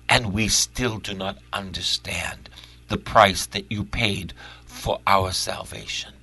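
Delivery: hushed whisper. Real, not acted. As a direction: breathe it out in a whisper. Whispered aside in sermon.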